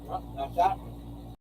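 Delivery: far from the mic, muttering. Real, not acted. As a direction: talk while walking away from the mic. Walked away from the mic searching.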